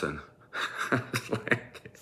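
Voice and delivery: ordinary talk, nothing marked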